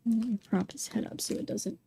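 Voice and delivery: unvoiced whisper while concentrating. Real, not acted. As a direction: murmur it under your breath. Careful handling of sculpture.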